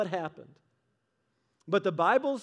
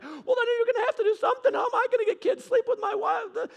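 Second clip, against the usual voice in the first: high, fast, put-on woman's voice with a laughing whine; hammed-up desperate pleading; frantic sister; 13 semitones higher than usual. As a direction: sister pushes her servant on Jacob, high and frantic. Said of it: "And she's like".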